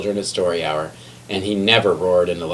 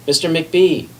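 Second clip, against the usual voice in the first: higher call down the hall; 9 semitones higher, slower than usual. A narrator's reading. Injured librarian calling her colleague, raised call. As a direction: call for help from the floor. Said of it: "she called after a minute".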